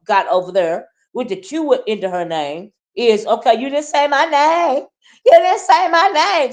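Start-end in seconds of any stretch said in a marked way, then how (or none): 3.3-6.5 high, whiny mocking of a petulant child. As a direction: whine it like a sulky kid. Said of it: "Sounding like a whiny, petulant child".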